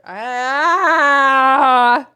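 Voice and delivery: long rising frustrated yell; open-throated scream that swells; loud drawn-out scream. Real, not acted. Streamer breaking off from reading the game, loud frustrated scream.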